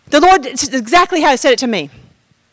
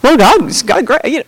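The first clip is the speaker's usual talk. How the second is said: usual speaking voice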